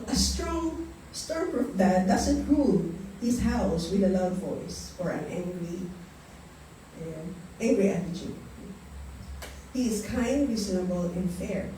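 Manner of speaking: slow, soft reading of a quote; reading a passage aloud. Acted. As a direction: read it gently and slowly, like a devotional. Reads a devotional passage. (mabagal at mahinahong pagbasa ng sipi)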